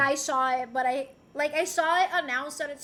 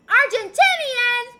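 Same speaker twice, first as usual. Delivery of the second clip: incredulous echo; 7 semitones higher, 12 dB louder, slower than usual. Real, not acted. Incredulous echo of chat word.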